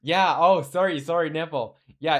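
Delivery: ordinary delivery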